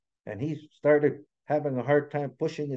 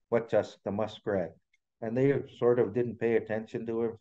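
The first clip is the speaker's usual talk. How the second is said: ordinary delivery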